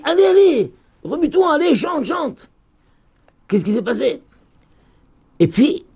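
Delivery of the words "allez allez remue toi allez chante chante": high, goading exhortation; urgent urging. Acted. They tell Deborah to get moving and sing, urging. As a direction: prod the singer on, high-pitched. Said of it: "on lui dit".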